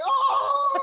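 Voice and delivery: very high, drawn-out "ohhh!"; crowd's "oh!". Retold or imitated. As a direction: imitate a whole audience erupting. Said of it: "Everybody feels like".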